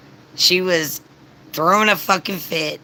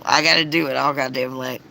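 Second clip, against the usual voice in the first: ordinary talk, nothing marked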